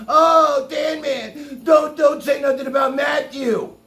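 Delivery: high, loud mocking whine; hammed-up pleading fan voice shouted; whiny pleading chatters. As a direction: mimic fans begging him to stop. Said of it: "And everybody's like".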